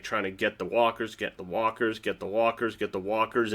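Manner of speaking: low, hushed mock calm; imitated calm. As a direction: repeat it softly, low and unhurried, imitating his calm. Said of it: "just calmly".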